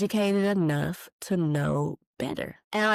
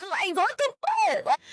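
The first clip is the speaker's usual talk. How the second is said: laughing disbelief. Real, not acted. Laughing outburst.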